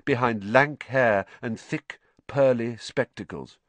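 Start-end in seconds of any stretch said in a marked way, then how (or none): none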